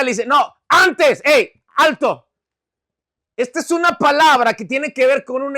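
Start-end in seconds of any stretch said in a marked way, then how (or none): 0.3-2.3 loud, raised halting command; acted emphatic stop; Jesús, firm interruption. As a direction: cut the enthusiasm short. Jesus cutting off the praise.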